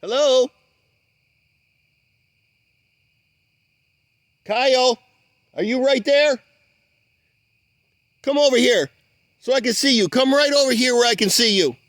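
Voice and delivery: high, loud calls into the dark, pleading; loud coaxing call. Real, not acted. Coaxing an unseen creature out of the swamp, loud and pleading. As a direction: coax him out, calling loudly. Calling to hidden creature Kyle.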